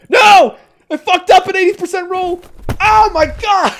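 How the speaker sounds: shrieking high despair at the failed roll; anguished scream. Real, not acted. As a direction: howl in real disbelief. Player fails a roll.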